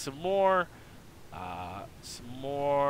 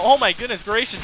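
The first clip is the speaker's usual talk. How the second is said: awed exclamation; 8 semitones higher, 8 dB louder than usual. Real, not acted. Awed exclamation during a fight.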